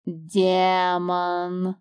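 the snake happily offering his word; glad hiss. A narrator's reading. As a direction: hiss it with relish. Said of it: "радостно прошипел Аспид".

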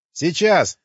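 high, loud shouted reply; calling back. A narrator's reading. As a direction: answer loudly across a distance. Calls back from a distance.

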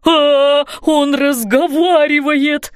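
slow, put-on panicky wail; wailing in fright. A narrator's reading. Roofer hearing the monster talk, wailing in fright. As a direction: draw out the horror in a frightened man's voice. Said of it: "завопил он".

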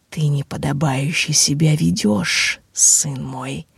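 low, rustling undead voice; inhuman rustling voice. Acted. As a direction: rasp it out like a risen corpse. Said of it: "прошелестела тварь нечеловеческим голосом".

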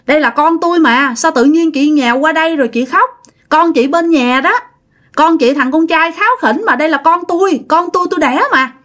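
high, fast, shocked denial; startled protest. Retold or imitated. Startled mother insisting the baby is hers, high and fast. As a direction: push back in alarm, words tumbling out. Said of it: "bà Mộc mới hết hồn".

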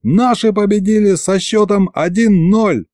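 high, ringside-style victory call; yelled announcement. A narrator's reading. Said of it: "заорал я". (выкрик на повышенном тоне)

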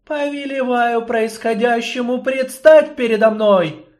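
loud, high, imperious command; commanding goddess. Acted. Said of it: "Властным, царственным жестом".

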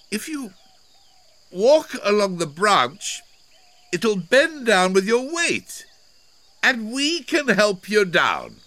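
high, loud, carrying voice; calling instructions. A narrator's reading. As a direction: call it clearly up to someone above.

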